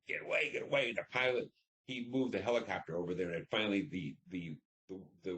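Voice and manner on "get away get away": high urgent yell to get away; retold yelling. Soldier yelling at a man in a boat to get away. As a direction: shout him off, panicking. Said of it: "I'm, like, screaming at him".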